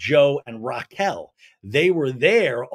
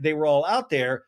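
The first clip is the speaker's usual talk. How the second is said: ordinary talk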